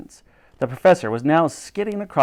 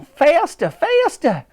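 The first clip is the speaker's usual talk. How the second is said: high, urgent call; 14 semitones higher, 6 dB louder, much slower than usual. A narrator's reading. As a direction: urge the Admiral on, much higher. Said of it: "She called out to the Admiral".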